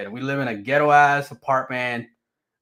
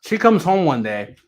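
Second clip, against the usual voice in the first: ordinary talk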